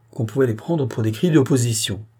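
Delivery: plain reading voice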